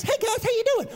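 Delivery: very high cartoon mouse voice; Mickey Mouse. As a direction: squeak it like a cartoon mouse preacher. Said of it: "preach and sound like Mickey Mouse".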